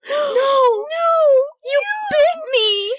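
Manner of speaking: very high, crying shout of panic; panicked crying. Retold or imitated. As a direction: wail in terror as the vampire bites. Bitten by vampire, panicked protest.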